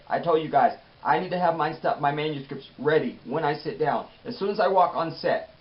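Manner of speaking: ordinary talk, nothing marked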